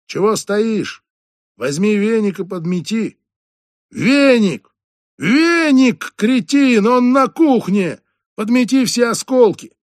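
loud, rising to very high yells; yelling boss. Acted. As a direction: scream orders at the dim janitor. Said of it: "заорал хозяин".

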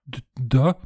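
put-on small, hesitant girlish peep; timid squeak. A narrator's reading. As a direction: squeak out a nervous answer. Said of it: "пискнула незнакомка".

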